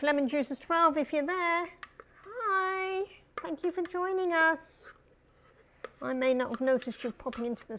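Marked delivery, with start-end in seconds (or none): 0.0-4.8 bright, high welcome to a viewer; excited high greeting. Real, not acted. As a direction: call out a cheery hello.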